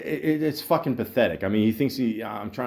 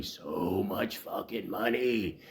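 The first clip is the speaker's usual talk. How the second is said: slow, hushed disbelief; drawn-out repeat; 5 semitones lower, 9 dB quieter, slower than usual. Real, not acted. Podcaster lamenting what the bartender costs the bar, slow and soft. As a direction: softly stretch out every word, dragging it slowly. Repeats phrase slowly for effect.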